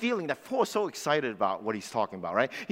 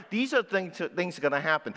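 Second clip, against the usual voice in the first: ordinary talk, nothing marked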